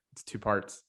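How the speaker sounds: ordinary talk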